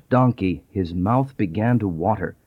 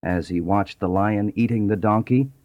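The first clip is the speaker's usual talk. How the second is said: ordinary delivery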